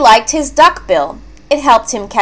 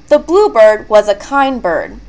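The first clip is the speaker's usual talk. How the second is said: ordinary delivery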